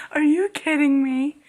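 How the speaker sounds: laughing disbelief. Real, not acted. Reacting to the kiss, laughing in thrilled disbelief. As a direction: squeal it in disbelief.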